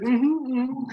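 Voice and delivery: high tight mm-hmm through a full mouth; strained happy mm-hmm. Retold or imitated. To Ellen, squeaky forced mm-hmm while choking. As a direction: fake an okay nod with a mouthful.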